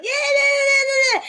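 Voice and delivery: loud, high imitated yelp of pain; mock scream. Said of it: "he was, like, screaming".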